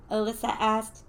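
usual speaking voice

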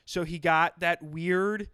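ordinary talk, nothing marked

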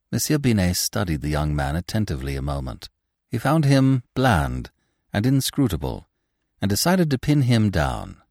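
plain reading voice

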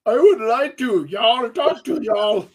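slow, loud put-on Southern drawl with an Indian tinge. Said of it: "that redneck voice with a little hint of Indian in it".

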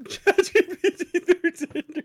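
repeating it through a real laugh, slowly; cracking up at the idea. Real, not acted. Laughing as he repeats his friend's dating joke.

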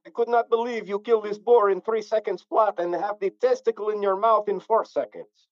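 mock Eastern European amazement; Eastern European accent. Friend marvelling at boar kill, in mock Eastern European accent. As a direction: marvel in a put-on Slavic accent. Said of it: "I do a mean Eastern European accent".

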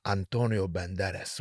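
low, soft Spanish accent; Antonio Banderas. Shown as a demonstration. Comic attempting the actor's own name, Spanish accent. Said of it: "like a Spanish accent".

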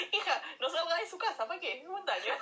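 high, laughing mock warning. Real, not acted. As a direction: warn through laughter. Mock advice while laughing. (advertencia en broma entre risas)

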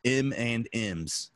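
"M and M's" with each word separate and slow; wife's pronunciation. Retold or imitated. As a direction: spell out "M and M's" slowly, full "and". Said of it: "And my wife says".

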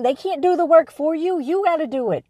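normal talking voice

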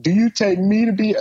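a high, hammed-up groom's vow; imitating groom. Dwayne asking her at the altar, hammed-up high. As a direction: overplay the eager lover. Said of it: "Dwayne is like".

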